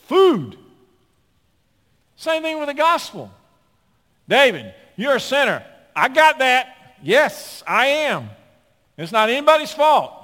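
loud, high shouting sermon; fiery preaching. Acted. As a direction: preach it with full fire. Preaching at full force.

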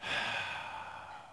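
long breathy sigh, acted out; imitated exasperated sigh; weary sigh. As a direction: let out a long exhale. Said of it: "The Bible says he sighed".

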